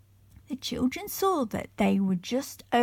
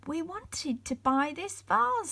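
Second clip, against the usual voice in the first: frightened; 9 semitones higher than usual. A narrator's reading. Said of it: "said Peter, frightened".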